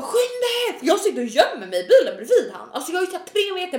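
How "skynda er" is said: high, pressing retold shout; acted high-pitched urging; urgent yell. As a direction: re-enact begging police to hurry. Urging police on the phone.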